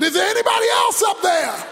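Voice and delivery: punchline yelled high into the distance; calling upward. Acted. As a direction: holler up for someone else. Joke's man calls up for rescue.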